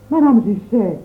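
ordinary delivery, nothing marked

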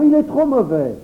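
put-on high, loud child shout; children shouting against the donkey; children's cry. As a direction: cry it out like angry kids. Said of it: "s'écrièrent tous les enfants".

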